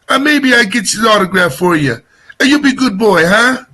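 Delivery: a hint of a Jersey Italian uncle on the phone; Tony Danza-style uncle. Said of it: "he talks like Tony Danza".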